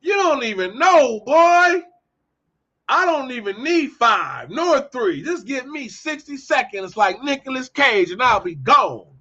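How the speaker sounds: high-pitched, worked-up trash talk; indignant telling-off, pitched high; heated challenge. Retold or imitated. Acted challenge, telling someone off.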